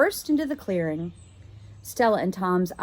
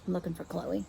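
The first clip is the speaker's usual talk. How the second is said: quiet aside; 8 dB quieter, faster than usual. Real, not acted. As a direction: mumble it half to yourself. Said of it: "reader's aside, quiet".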